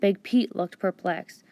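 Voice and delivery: plain reading voice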